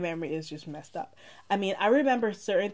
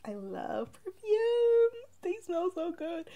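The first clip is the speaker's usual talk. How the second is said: swooning gush; 12 semitones higher, slower than usual. Real, not acted. Gushing about loving perfume.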